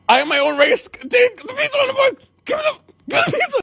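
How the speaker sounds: high squealed recital, rushing at the end; exasperated repeating. Retold or imitated. Customer made to repeat the risk sentence, exasperated. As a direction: parrot it back in a squeaky mock-desperate voice. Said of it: "repeat the following sentence".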